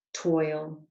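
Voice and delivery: ordinary delivery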